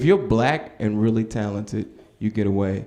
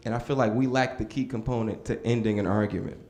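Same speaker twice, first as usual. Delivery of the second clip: ordinary talk, nothing marked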